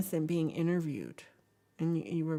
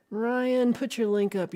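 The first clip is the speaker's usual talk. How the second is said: high, loud, drawn-out name; calling out; 7 semitones higher, 6 dB louder, slower than usual. Real, not acted. Streamer telling viewer to post the interview link, calling out. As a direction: sing out the name and instruction.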